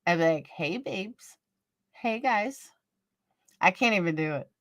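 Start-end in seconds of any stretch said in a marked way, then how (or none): 0.5-2.7 breathy seductive hey, much softer; a hint of a sex-kitten purr; mock sultry voice. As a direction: play a sultry vixen greeting fans, half-joking. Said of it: "I'd sound like a sex fiend".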